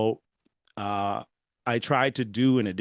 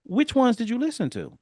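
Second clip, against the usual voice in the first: future self voice; 12 semitones higher, 3 dB louder than usual. Retold or imitated. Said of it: "my future self was like".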